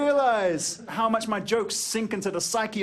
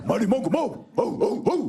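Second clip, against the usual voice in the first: mock gang chant in dog barks; hammed-up doggy war cry; barking gang; 3 semitones higher than usual. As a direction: bark it like a pack of hounds. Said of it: "communicate in barks".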